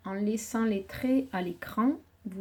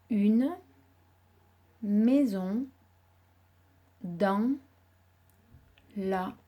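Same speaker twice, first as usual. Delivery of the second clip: one word at a time, long pauses; mot par mot; much slower than usual. Shown as a demonstration. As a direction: dictate each word slowly with gaps. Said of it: "tracé les traits pour représenter chacun des mots". (dictée mot par mot, avec de longues pauses)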